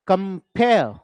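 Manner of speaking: "Compare" broken as com-PARE, second part stronger; stress on second syllable. Shown as a demonstration. Teacher sounding out syllables to count meter, stress on "pare" in "Compare". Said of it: "stressed।".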